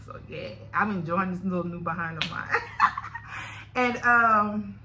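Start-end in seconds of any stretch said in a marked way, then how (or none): 2.5-3.7 squeaky breathless laugh; high giggle. Real, not acted. Joking with viewers about her body, high giggle. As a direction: laugh off the joke.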